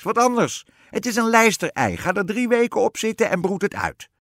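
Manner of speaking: high, loud witch giving orders; emphatic storybook witch; bossy witch. A narrator's reading. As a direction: lay down instructions in a piercing witch voice. Witch gives queen brusque orders.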